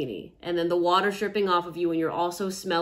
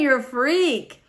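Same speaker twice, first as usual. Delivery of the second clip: slow, high, loud teasing; playful tease; 7 semitones higher, 6 dB louder, slower than usual. Pretend. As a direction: drag it out, mock scandalized. Playful teasing after hug.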